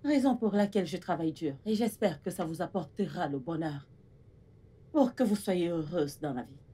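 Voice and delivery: low, soft motherly declaration; tender. Acted. Mother telling her daughters she loves them, tender. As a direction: speak softly from the heart.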